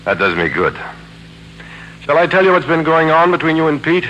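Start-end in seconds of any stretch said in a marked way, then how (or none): 2.0-4.1 loud, high jealous jab; taunting shout. Acted. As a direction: hurl the question at her loudly.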